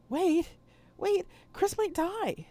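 begging the med bay not to hang up; frantic shout. Acted. Girl pleading with med bay.

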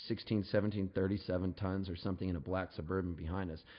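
ordinary talk, nothing marked

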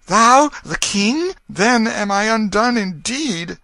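very high, loud and drawn-out alarm; dismayed exclamation. A narrator's reading. Poor Tom realizing he faces the king, high horrified exclamation. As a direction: cry out slowly in high dismay. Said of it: "he exclaimed".

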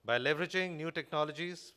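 ordinary talk, nothing marked

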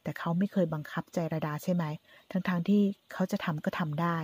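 plain reading voice

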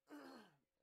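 loud throat clear. Real, not acted.

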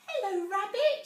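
a tiny mouse gasping in wonder; little mouse voice. A narrator's reading.

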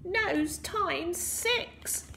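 very slow, high squeaky spell; Rumpelstiltskin. A narrator's reading. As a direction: draw out the incantation in a squeaky pitch. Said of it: "Rumpelstiltskin pointed at the king".

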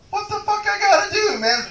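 exasperated high yelp. Real, not acted. Comic complaining to the crowd about his lady, high exasperated yelp. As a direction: let the frustration squeak out.

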